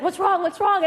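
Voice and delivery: high, loud panicked questioning; imitated alarm. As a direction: urgently act out an alarmed friend. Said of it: "he thinks my entire family has been assassinated".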